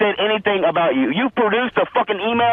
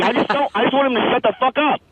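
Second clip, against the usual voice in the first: normal talking voice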